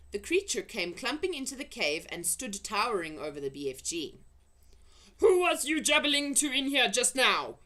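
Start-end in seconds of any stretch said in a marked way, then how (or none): 5.2-7.6 loud, high giant boom. Acted. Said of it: "he boomed".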